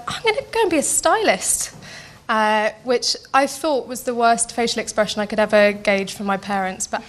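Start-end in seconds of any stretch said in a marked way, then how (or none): 0.1-1.7 mock chirpy announcement. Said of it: "and then I dropped out of college after two months and went".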